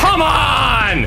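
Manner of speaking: loud high shout; frustrated shout. Real, not acted. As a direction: shout it at the game. Frantic firefight, shouting.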